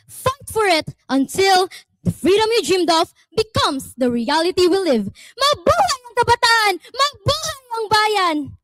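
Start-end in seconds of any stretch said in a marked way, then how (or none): 5.4-8.6 roaring rallying speech. Acted. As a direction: rouse the crowd to their feet. Closing rallying cry.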